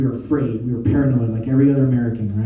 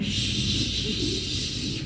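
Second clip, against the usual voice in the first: soft hissing spray noise; hushed spraying hiss; 10 dB quieter, much slower than usual. Retold or imitated. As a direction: mimic an aerosol can.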